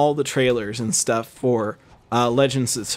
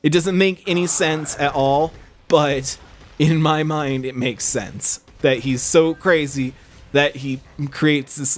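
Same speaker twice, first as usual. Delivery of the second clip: ordinary talk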